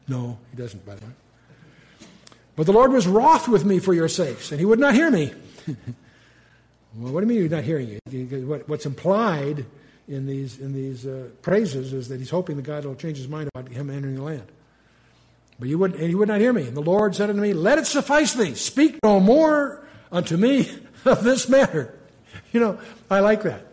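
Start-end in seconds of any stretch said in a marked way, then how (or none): 2.6-5.3 louder, raised reading of the verse; scripture reading. Acted. Preacher reading scripture to the class. 15.6-21.9 higher, louder verse, breaking into a laugh; scripture reading. Acted. Teacher reads God telling Moses to stop.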